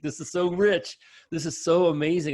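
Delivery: high and loud, gushing with delight; thrilled. Retold or imitated. Host gushing over the guest's insight, high and loud. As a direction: burst out in amazed praise. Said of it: "I was just like".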